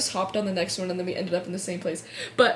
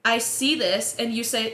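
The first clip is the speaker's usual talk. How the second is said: usual speaking voice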